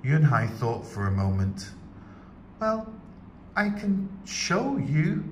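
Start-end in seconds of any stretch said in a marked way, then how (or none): 2.6-5.2 put-on higher, slow little girl voice. A narrator's reading. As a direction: voice a shy young girl.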